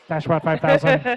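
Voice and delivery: playful, lightly put-on robot voice. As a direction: say it like a mock robot. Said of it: "The Stashbot voice".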